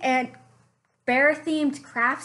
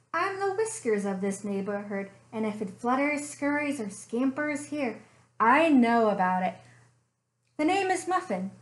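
softer, storytelling read-aloud voice; story narration; 4 semitones lower, 3 dB quieter than usual. A narrator's reading. Librarian reading a bear story to children, gentle storytelling. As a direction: draw the kids into a cozy mystery tale.

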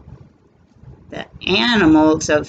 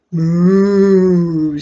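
louder drawn moo; cow moo; 4 dB louder than usual. Acted. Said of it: "says the cow".

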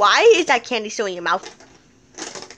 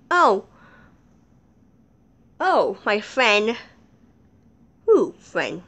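ordinary delivery

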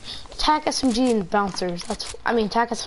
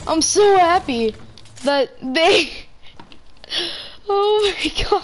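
joyful burst; 6 semitones higher than usual. Real, not acted. Burst of joy, laughing.

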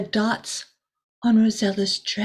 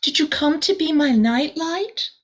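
put-on higher, hopeful little girl asking; 7 semitones higher, 3 dB louder than usual. Rosella asking the star, higher and hopeful. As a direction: ask with bright hope in a higher voice. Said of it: "Rosella asked".

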